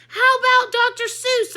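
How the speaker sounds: high loud cheeky shout; shouted suggestion. A narrator's reading. The narrator joking amid chaos, a loud cheeky shout. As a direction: shout the silly idea over the noise. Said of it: "I suggested".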